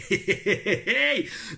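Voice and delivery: éclat de rire aigu. Real, not acted. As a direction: rire franc d'amusement. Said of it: "votre accent me fait délirer". (rire franc en pensant à l'accent québécois)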